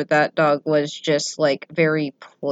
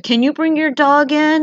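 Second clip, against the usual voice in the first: higher, louder imitation of someone calling over; worried delivery person; 10 semitones higher, 5 dB louder than usual. Delivery person asking about a dog in the yard, high worried call. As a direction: call it out high, as a nervous visitor.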